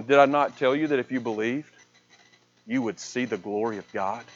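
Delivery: low, hushed answer as Jesus; quiet reply. Retold or imitated. Jesus answers grieving Mary about believing, quiet reply. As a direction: speak softly and low, fading further.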